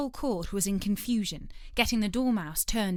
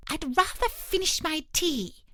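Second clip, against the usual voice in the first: high, nervous plea; a worried hatter eyeing the Queen; anxious Hatter; 7 semitones higher than usual. A narrator's reading. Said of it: "with an anxious look at the Queen".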